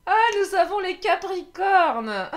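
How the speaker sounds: loud, slow, laughing reveal; card reader thrilled as the signs turn up, announcing loudly and slowly while laughing; excited announcement. Real, not acted. Laughing excited reveal.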